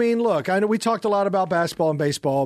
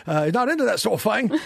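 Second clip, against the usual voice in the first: put-on Cockney accent; 6 semitones higher than usual. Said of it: "Yeah, I got a Cockney accent".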